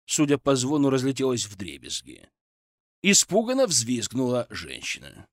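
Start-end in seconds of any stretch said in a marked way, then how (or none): none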